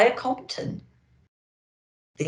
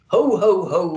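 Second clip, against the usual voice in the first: loud, hearty ho ho ho; Santa laugh; 8 dB louder than usual. Acted. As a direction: boom out a jolly Santa laugh. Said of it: "laughed Santa".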